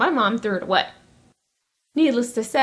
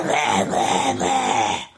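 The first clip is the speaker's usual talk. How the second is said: deep guttural growl, far lower; mock metal growl; much slower than usual. Mocking sister's metal music with a deep guttural growl. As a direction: growl it like a heavy metal singer. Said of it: "the music she listens to is rah".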